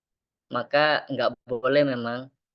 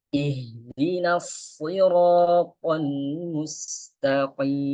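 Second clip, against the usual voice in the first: Quran recitation; 2 semitones higher, much slower than usual. Shown as a demonstration. Teacher recites the Fatihah verse for the class. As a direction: recite slowly and calmly.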